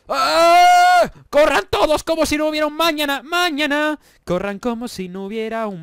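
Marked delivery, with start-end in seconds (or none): 0.1-4.0 mock alarm. Calling everyone to run from TNT.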